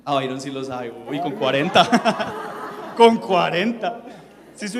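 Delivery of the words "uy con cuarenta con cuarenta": laughing through the words; laughing tease. Real, not acted. Teasing Byron about his age, laughing. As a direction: tease him while laughing.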